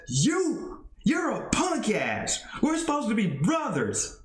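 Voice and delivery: high, heated callout; angry trash talk. Acted. Iceberg Isaac confronting Mason in the ring, heated callout. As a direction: get in his face and call him out. Rival wrestler confronting partner.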